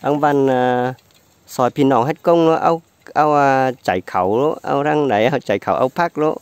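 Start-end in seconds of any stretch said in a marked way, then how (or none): none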